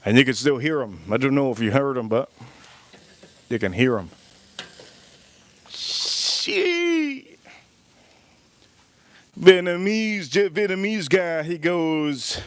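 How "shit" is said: high, drawn-out startled cry; startled curse. Real, not acted. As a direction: jolt as the noise hits. Startled by a sudden loud noise.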